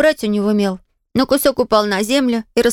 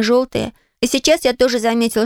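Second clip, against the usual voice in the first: plain reading voice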